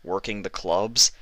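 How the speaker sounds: usual speaking voice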